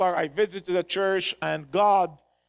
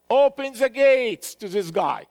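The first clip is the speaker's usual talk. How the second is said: raised, higher order to the troops; retold soldier's call; 4 semitones higher, 4 dB louder than usual. Soldier telling all the others to open the gates, retold call. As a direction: call it out like a border guard.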